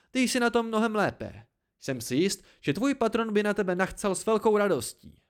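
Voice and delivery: high, biting sarcasm; sting with mock praise; snide. A narrator's reading. Narrator sneers about Dena's patron, snide. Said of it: "řekl jsem jízlivě".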